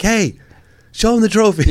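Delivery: high-pitched teasing call-out; mock heckling. Guest teasing the host about his trophy, mock heckling. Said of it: "Now I'm gonna be like".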